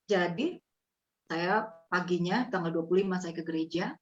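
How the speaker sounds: normal talking voice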